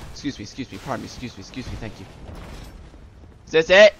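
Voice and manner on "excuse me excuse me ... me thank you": soft, low, hurried apologies; little quiet muttered pardons; polite squeezing past. Acted. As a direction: murmur apologies while slipping through a crowd. Acts slipping past soldiers.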